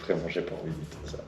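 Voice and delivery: very soft low mutter; quiet mutter. Real, not acted. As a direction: mutter it to yourself. Dreading the mousetrap.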